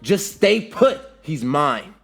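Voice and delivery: high, loud, angry command; forceful angry reply; angry retort. A narrator's reading. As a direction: snap back angrily, starting high. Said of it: "Sasuke responds angrily".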